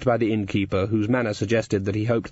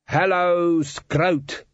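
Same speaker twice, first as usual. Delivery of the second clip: yell; 7 semitones higher, much slower than usual. A narrator's reading. Dwarf standing in cart greeting empty town, yelling. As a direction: shout the town's name out. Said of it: "He yelled".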